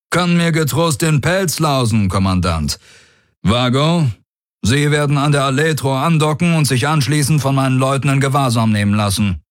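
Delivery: high, brisk, assured orders; commanding warlord. Acted. Daraios dismissing threats and giving docking orders, high and commanding. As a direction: take charge without hesitation.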